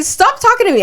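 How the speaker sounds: very high, loud squealed protest; mock outrage. Telling friend how she rebuffed a coworker, squealing disgust. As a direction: squeal it like you're grossed out.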